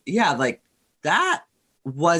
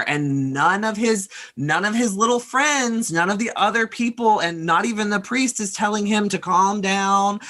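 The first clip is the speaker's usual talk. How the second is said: ordinary talk, nothing marked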